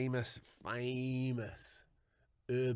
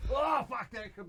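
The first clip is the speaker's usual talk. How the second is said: much higher and louder, recoiling; disgusted shock; 12 semitones higher, 3 dB louder than usual. Real, not acted. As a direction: yelp it like you just tasted it. Reacting to gross story.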